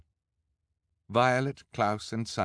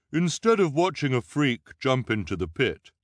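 loud, raised put-on voice; persuasive disguised voice; 6 semitones higher, 5 dB louder than usual. A narrator's reading. Violet in disguise stalling the crowd, loud persuasive put-on voice. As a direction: pitch it up and project to the crowd. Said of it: "Violet said, thinking quickly".

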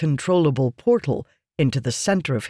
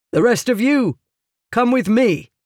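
high, loud rallying order; rally the men to follow; commanding; 7 semitones higher, 4 dB louder than usual. A narrator's reading. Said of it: "he commanded".

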